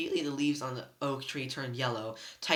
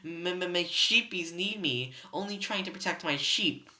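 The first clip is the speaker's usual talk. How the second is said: sobbing plea; 5 semitones higher, slower than usual. A narrator's reading. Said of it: "He began to sob".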